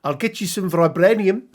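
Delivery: put-on loud, hammy South African accent; bad South African villain accent. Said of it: "terrible South African accent".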